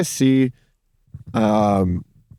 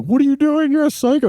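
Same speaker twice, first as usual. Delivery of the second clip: much higher-pitched mimicked outcry; mock alarm. Friend retelling bystanders' reaction to a street fight, high mock alarm. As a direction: squeal it high, imitating alarmed onlookers. Acted startled bystanders in retold quarrel.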